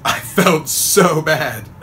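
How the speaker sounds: slow laughing confession; real laughter while admitting guilt. Real, not acted. Laughing at own lie.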